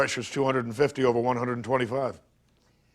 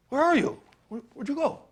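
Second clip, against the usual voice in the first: much higher call, searching; 12 semitones higher than usual. Acted. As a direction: call out into the emptiness for him. Calling out for Scott.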